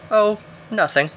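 high, airy feigned innocence; coy eagle. A narrator's reading. Eagle baits climber with false innocence, coy. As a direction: dangle it, pitched well up.

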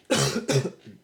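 sudden harsh cough; coughing fit. Real, not acted. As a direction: cough it out hard. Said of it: "Jesus, fuck, hold on".

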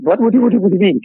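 stunned high-pitched question; retold disbelief. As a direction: replay your stunned reaction, high and quick. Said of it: "I said".

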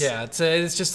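usual speaking voice

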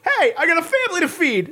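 street vendor voice. Retold or imitated. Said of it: "the guy's like".

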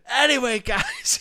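booming, high theatrical butting-in; loud interruption. Retold or imitated. Biggins cutting into a colleague's rehearsal, loud and high. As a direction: mimic someone breezily barging in at full volume. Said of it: "he came and interrupted her".

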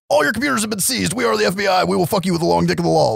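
high, fast mock agent spiel; FBI raid announcement. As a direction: rattle it off as a raiding federal agent. Raiding FBI agents announce seizure.

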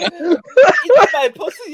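mock porn moan. Mock German porn woman.

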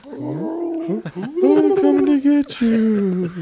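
very slow, high spooky ghost wail; haunt them with a drawn-out wail. Retold or imitated. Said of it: "Matt did come in there like Casper".

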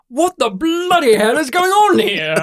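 high, loud hammed-up British shout; over-the-top John Hurt style outburst; mock shouting Brit. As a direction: bellow it like a startled old Englishman. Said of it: "in a shouting voice".

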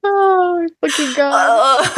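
high, slow, wheezing with laughter; laughing hard. Real, not acted. Laughing helplessly at her own accent. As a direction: gasp the words out laughing.

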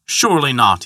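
plain reading voice

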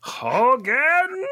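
high German officer call; calling out as Klink; German accent. Acted. Answers as Klink.

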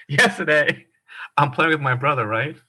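ordinary delivery

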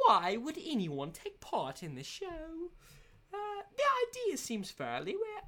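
exaggerated posh British reviewer voice; put-on British accent. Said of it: "I'm really lathering on to keep these entertaining".

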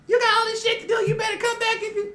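very high, put-on scolding voice; retold phone yelling. Woman calling him to come back, high scolding. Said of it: "she was yelling at him".

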